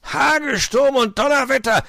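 loud, high outburst; angry storybook shout; furious curse. A narrator's reading. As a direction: explode with exasperation. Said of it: "stieß die Skistöcke wütend in den Schnee".

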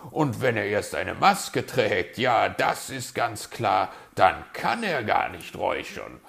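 loud, lively, pleased with himself; self-satisfied fox. A narrator's reading. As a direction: announce the clever fix smugly. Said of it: "sagte er zufrieden".

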